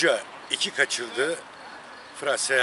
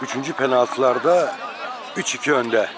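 live sports call, measured build; play-by-play commentary; 4 dB louder than usual. Acted. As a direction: call each penalty as it happens. Live penalty shootout commentary.

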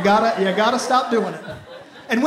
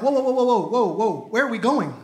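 alarmed. Retold or imitated. Panicked at Victoria's Secret.

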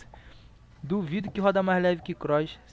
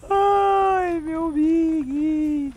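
much higher, louder, teasing whine; high mock voice; 12 semitones higher, 7 dB louder, much slower than usual. High mock cry to teammate.

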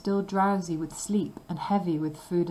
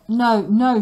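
loud, higher refusal; wife crying no; cried out; 4 semitones higher, 10 dB louder than usual. A narrator's reading. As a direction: cry it out sharply. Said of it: "she cried".

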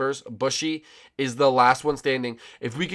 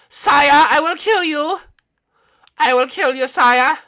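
high, loud cartoon villain; shrieky mock menace; villainous threat voice; 14 dB louder, slower than usual. Villain character threatens player.

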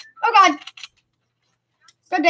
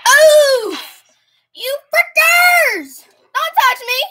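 panicked yell; 6 semitones higher, much slower than usual. Real, not acted. Panicked yelling at enemies.

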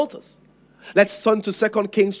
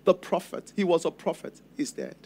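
much softer, barely getting the words out through tears; tearful, broken words; 5 semitones lower, 9 dB quieter than usual. Acted. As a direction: speak as a widow choking on her grief, low and soft. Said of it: "it's difficult to express ourselves".

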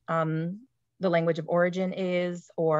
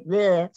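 drawn-out nonsense noise; non-English noise; 6 dB louder than usual. Shown as a demonstration. Teacher showing a sound English doesn't have, silly nonsense noise. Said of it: "We don't, we don't say".

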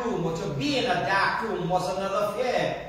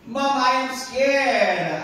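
put-on scared child; 5 semitones higher, 3 dB louder, slower than usual. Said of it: "Johnny was scared".

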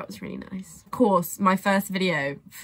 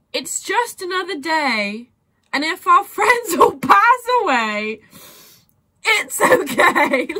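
higher, tearful with a laugh breaking in; teary quote; 8 semitones higher, 4 dB louder than usual. Real, not acted. Fan quoting the sad lyric, laughing and crying. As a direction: recite the lyric through tears.

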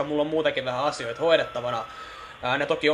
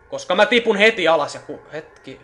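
sudden alarmed outburst, louder; startled; 5 semitones higher, 8 dB louder than usual. Real, not acted. Player falling in the game, startled outburst. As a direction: caught off guard by a sudden drop.

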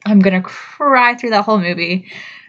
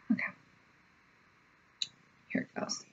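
whisper; 20 dB quieter, much faster than usual. Real, not acted. Whispering as trailer begins.

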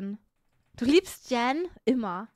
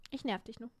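much softer, deflated cat voice; kleinlaute Katze; 3 semitones lower, 10 dB quieter than usual. Acted. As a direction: back off meekly and quietly. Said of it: "die Katze ist dann so". (leise, kleinlaut)

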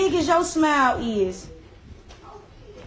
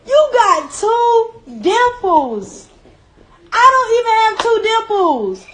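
high, slow, tearful praise; higher, slow words on the verge of crying; near tears; 8 semitones higher, 5 dB louder than usual. Real, not acted. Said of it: "you just almost made me cry".

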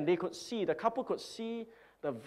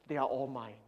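softer, lower gloating; quiet possessive gloat; smug aside; 4 semitones lower, 7 dB quieter than usual. Acted. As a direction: keep it to yourself, savoring it. Boaster's smug punchline.

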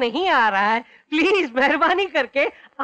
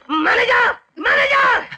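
angry call; 9 semitones higher, 4 dB louder, slower than usual. Acted. Furious guest yells for the manager across the restaurant. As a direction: bellow the name high and loud.

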